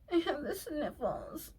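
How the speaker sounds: high, soft, slow fake-tearful whimper; mock whining man. Mocking a partner with a headache, pitiful whiny voice. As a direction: whimper it pathetically, making fun of him. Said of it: "this man's over here like".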